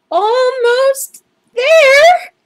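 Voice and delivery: long stretched-out high strain; straining to reach. Acted. Librarian straining for a high shelf, slow strained high voice. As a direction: reach on tiptoe and stretch the words out.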